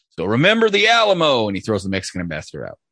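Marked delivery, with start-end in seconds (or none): 0.1-1.5 high, loud whoop; hammed-up patriotic shout; rallying cry. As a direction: belt it like a Texan war cry.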